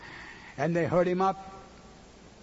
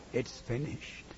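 low, soft hushed words; 5 semitones lower, 8 dB quieter than usual. Acted. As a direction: pass it on softly. Birds tell the clouds.

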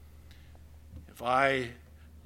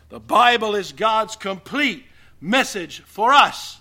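forceful preaching; 6 semitones higher, 7 dB louder than usual. Acted. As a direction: hammer each phrase to the congregation.

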